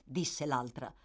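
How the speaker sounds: ordinary delivery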